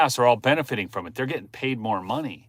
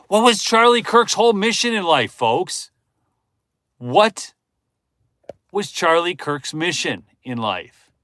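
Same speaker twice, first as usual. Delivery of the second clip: loud, pitched-up challenge, last words slowed; loud rhetorical question; 6 semitones higher, 5 dB louder than usual. Real, not acted. A streamer presses the audience with a loud question. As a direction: demand an answer from the folks.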